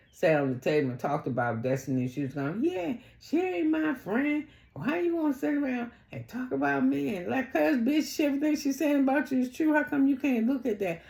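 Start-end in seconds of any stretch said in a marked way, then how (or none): 2.6-11.0 high-pitched indignant retelling; mock accusing questions, pitched way up; mimicking Tisha. Acting out Tisha's gossip at table.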